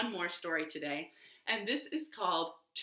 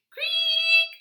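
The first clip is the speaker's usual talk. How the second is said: high creak; 8 dB louder than usual. Pretend. Creak effect.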